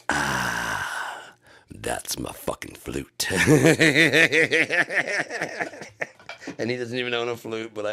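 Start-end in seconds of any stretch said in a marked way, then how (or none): 0.1-3.3 low, slow, lightly voiced punchline; a hint of a deadpan low delivery; Pete Holmes impression. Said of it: "he came to the line". 3.3-6.1 loud high burst of laughter; big laugh. Real, not acted. Laughing at his own bit.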